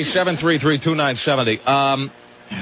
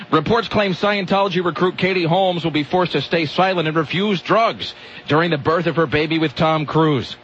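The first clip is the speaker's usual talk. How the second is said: ordinary talk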